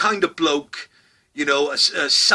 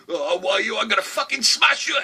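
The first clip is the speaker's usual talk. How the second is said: a hint of an angry grandson, higher voice; mock threat; 8 semitones higher than usual. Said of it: "grandson threatens".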